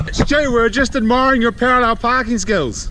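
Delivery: loud friendly call across the street. Real, not acted. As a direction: call out to a mate, loud and bright. Calling out to a friend.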